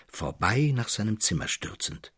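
usual speaking voice

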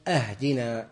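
"أهدنا" opened with a full glottal a-, ahdinā; wrong hamza a-hdinā. Shown as a demonstration. Teacher demonstrates the mistaken "أهدنا" with a-. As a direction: say "أهدنا" as ahdinā, like asking a gift. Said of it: "والذي يقول".

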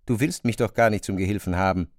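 ordinary talk, nothing marked

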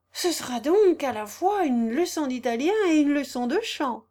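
exclaiming. A narrator's reading. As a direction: burst out with joy at the idea. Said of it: "s'écria Joseph".

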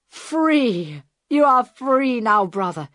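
high, loud and elated; jubilant welcome. A narrator's reading. Hijackers embrace their freed comrade, jubilant exclamation. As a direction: cry out in joy at his release.

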